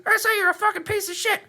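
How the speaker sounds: high, rude dummy insult; rude puppet voice. Pretend. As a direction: squeak the insult like a smartass dummy. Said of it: "you do the voice".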